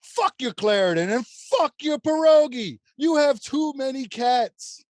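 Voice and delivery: put-on high, loud accented outburst; irate landlord. Landlord exploding at tenant over cats, loud accented rant. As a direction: blow up at the tenant in a hammy accent.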